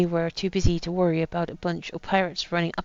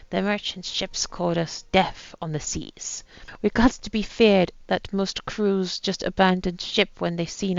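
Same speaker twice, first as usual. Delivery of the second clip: put-on light pirate accent reading a captain's journal; lightly voiced pirate accent, a gruff old sea captain. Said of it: "with an accent I've decided to put on".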